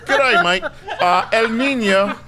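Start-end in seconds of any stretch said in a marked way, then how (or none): 0.1-0.6 mock Australian. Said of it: "That's more Australian".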